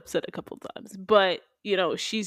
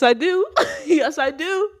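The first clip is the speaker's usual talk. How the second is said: usual speaking voice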